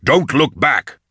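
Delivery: urgent command. Acted. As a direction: hurry them along, pitched high. Urging companions to flee.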